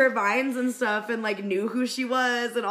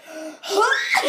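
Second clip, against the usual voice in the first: loud, high gasped huh; mock shock; 9 semitones higher, 5 dB louder than usual. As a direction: imitate a stunned, overcome fan. Retold shocked reaction to being noticed.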